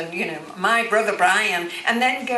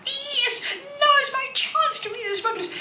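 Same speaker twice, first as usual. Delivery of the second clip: much higher, laughing delight. Retold or imitated. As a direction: squeal it with glee. Retells her delight at the offer.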